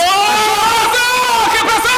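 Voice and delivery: very high, drawn-out exclamation; excited near-miss cry. Real, not acted. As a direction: cry out as the chance unfolds. Near miss in live play.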